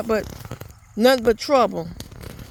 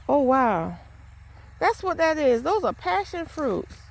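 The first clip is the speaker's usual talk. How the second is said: higher-pitched, delighted discovery; real surprise, pitched up; pleasant surprise; 6 semitones higher than usual. Real, not acted. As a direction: marvel at an unexpected find. Spots passion fruit growing outside.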